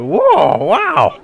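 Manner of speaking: high, loud mock yelp; hammed-up high "whoa, wow"; imitated startled jump. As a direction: mimic someone jolting out of a seat. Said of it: "He just, he just jumped up off his seat a little bit".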